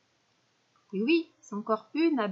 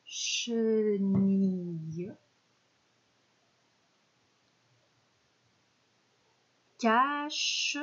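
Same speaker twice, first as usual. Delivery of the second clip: slow checking; much slower than usual. Shown as a demonstration. Teacher checking the sentence word by word, slow. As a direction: stretch each word slowly with pauses. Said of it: "on va écrire la phrase".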